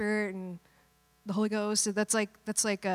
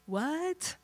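mock disbelief; 5 semitones higher, 6 dB quieter than usual. Mock disciple reaction.

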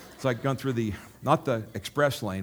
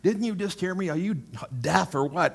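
high-pitched, mock-indignant retort; retold exasperation; 7 semitones higher, 3 dB louder than usual. Rose turning down a second invitation, imitated exasperation. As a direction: act out her fed-up comeback, voice jumping up.

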